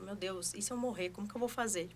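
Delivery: a quiet, anxious "what if I die"; hushed worried murmur. Retold or imitated. New mother recalling her panic, hushed worry. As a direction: murmur the fear under her breath. Retold panicked thought, much softer.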